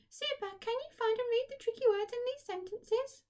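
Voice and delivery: very high, squeaky puppet voice full of cheer; a chirpy puppet praising the children; Kathy puppet voice. Acted. To the class, puppet Kathy cheers them on, squeaky and high.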